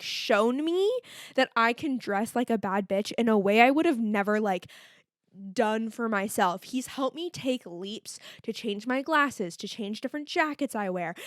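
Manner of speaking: ordinary talk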